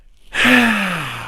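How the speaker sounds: loud, high exhaled sigh; big sigh. Real, not acted. Host wraps up the tagline tangent, big loud sigh. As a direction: let out a long, loud sigh.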